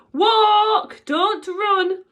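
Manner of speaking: high, loud teacher's yell; screaming. A narrator's reading. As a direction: scream it down the corridor.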